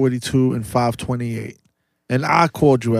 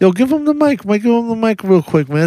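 high, loud excited call; 8 semitones higher, 4 dB louder, faster than usual. Real, not acted. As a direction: hype it up. Excitedly calling for the mic.

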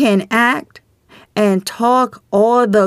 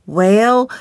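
ordinary talk, nothing marked

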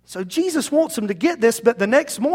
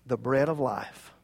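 low, much softer finish; quiet, low-pitched tail; lower-case afterthought; 9 semitones lower, 11 dB quieter than usual. Shown as a demonstration. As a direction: let the phrase drop right down. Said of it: "He could have said in capital letters".

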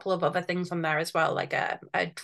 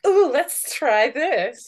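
excited; 6 semitones higher, 8 dB louder, much slower than usual. Retold or imitated. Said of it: "I'm always gonna be like".

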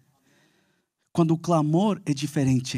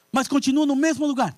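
doubting complaint; 9 semitones higher than usual. Retold or imitated. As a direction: push back in doubt.

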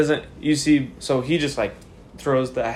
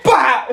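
sharp, much higher, louder "Bah!"; mock battle cry; 12 semitones higher, 8 dB louder than usual. GM voices player's smack with a loud cry. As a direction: bark it like a playful smack. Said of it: "You go".

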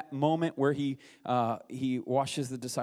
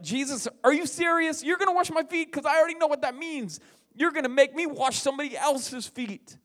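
much higher, mock disbelief at Jesus; incredulous Peter; 12 semitones higher, 6 dB louder than usual. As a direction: play Peter aghast at the offer. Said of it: "He knew, like".

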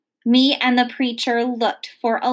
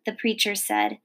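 plain reading voice

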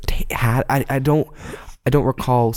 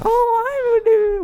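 put-on very high, drawn-out British accent; hammed-up British accent, stretched and squeaky; mock British accent; slower than usual. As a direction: mimic an exaggerated British accent, high and slow. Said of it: "whatever you do".

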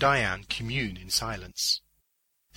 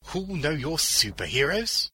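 higher, rising sceptical question; scornful repeat, pitched up at the end; mocking echo; 6 semitones higher, 4 dB louder than usual. A narrator's reading. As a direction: throw the question back at them, amused. Old man echoes Beaners, amused.

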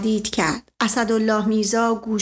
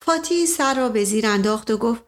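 plain reading voice

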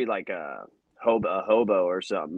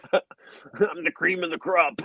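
wrestling promo voice; 7 semitones higher, slower than usual. Pretend.

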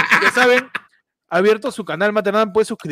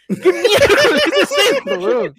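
high, slow, laughing outburst; laughing disbelief; 12 semitones higher, slower than usual. Pretend. Host laughing at a maths video he can't follow, high and slow. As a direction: squeal it through laughter, baffled by the maths. Mock viewer baffled by math video.

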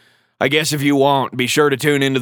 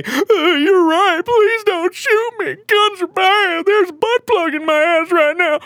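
much higher, slow, whimpering wimp voice; mock crying; slower than usual. Host mocking a weakling who gives up his gun, high slow fake crying. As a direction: whine it out like a sobbing coward. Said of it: "start crying".

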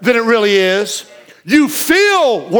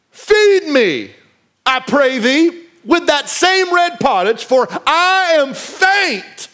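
preached scripture reading; 5 semitones higher, slower than usual. Acted. Pastor reads Esau's plea.

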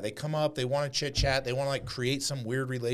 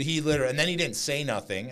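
normal talking voice

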